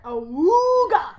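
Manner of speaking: drawn out cartoon ahooga; ahooga horn. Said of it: "in the cartoon".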